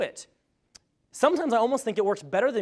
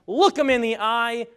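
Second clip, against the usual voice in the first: normal talking voice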